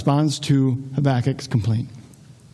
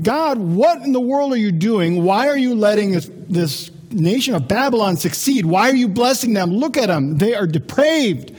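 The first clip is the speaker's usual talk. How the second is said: higher-pitched cry of protest to God; complaining upward, voice raised in pitch; indignant complaint; 4 semitones higher than usual. Acted. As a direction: plead with God in exasperated disbelief. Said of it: "Habakkuk is complaining".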